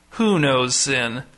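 slow question with WHO drawn out and stressed. A narrator's reading. Pastor repeating his question from the pulpit, stressing "Who". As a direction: lean hard on "Who", let the rest fall. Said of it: "rotating emphasis on each word".

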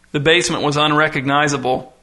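usual speaking voice

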